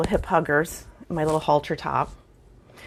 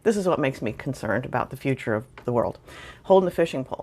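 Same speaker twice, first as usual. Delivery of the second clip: normal talking voice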